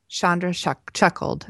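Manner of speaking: ordinary delivery, nothing marked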